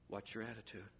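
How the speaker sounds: almost under breath. Shown as a demonstration.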